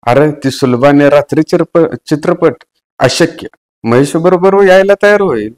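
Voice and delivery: high, loud mocking exclaim; shouting in disbelief. A narrator's reading. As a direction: call it out, teasing and incredulous. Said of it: "Vikas ओरडलाच".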